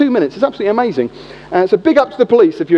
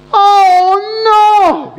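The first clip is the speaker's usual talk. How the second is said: high, loud mock alarm of a caught thief; startled bloke; 10 semitones higher, 4 dB louder than usual. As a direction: squeal it like a guilty bloke caught out.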